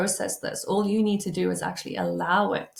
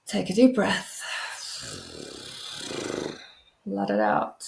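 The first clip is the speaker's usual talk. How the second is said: calm them down one breath at a time; guided breathing. Acted.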